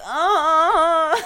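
loud, high labor moan; hammed-up wail of labor pain; reenacted labor groan. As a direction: groan loudly, acting out a contraction. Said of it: "and I'm like".

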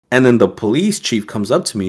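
normal talking voice

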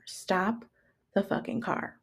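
hushed repeated command, broken by a pause; menacing roommate order. Retold or imitated. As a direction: imitate quiet, controlled fury. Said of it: "my roommate has the audacity to say".

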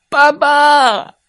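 put-on high, loud child's cry; baby dinosaur shout. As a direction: call out to dad happily. Babies call dad.

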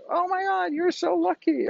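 high, mock-excited neighbor voice; gushing neighbors. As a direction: squeal it like a gossiping neighbor. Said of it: "all the neighbors are like".

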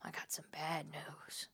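slow, breathy psychic whisper; ominous whisper. Retold or imitated. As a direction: hush it like a grave omen. Psychic delivers ominous news.

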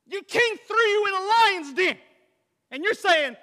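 loud, high astonished outburst; amazed exclaiming. Real, not acted. Preacher marvels to the church at Daniel, loud and high.

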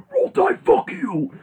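high, slow mock yell; Coach O shout. Host mimics Coach O taunting Alabama with a high yell. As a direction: bellow it like a fired-up coach. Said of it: "he was like".

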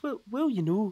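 bad Geordie attempt that turns out Irish; accidental Irish accent. Impersonating Steve Bruce as a pundit, accidental Irish accent. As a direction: put on an Irish lilt by mistake. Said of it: "I don't know why I went Irish there".

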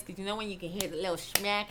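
ordinary talk, nothing marked

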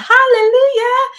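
very high, loud, drawn-out cry; long ringing church holler; exultant praise shout. Real, not acted. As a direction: belt it out in joyful praise. Exclaims praise after reading foreign phrase.

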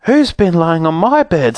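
high, indignant mother bear. A narrator's reading. Mother bear finds someone in bed, indignant. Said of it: "said the mother bear".

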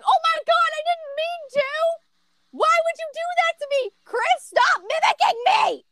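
high, whiny fake sobbing; mock crying. Mimicking the friend's fake crying to her parents, high and whiny. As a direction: wail it like a fake-crying kid. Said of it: "starts crying, saying".

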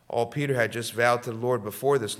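ordinary talk

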